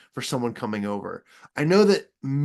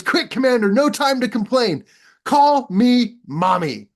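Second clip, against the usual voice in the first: higher, louder mock-urgent hero; Rocket Man urging; 9 semitones higher, 5 dB louder than usual. Rocket Man pressing the commander again, mock urgent.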